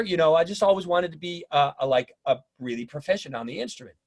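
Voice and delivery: ordinary talk, nothing marked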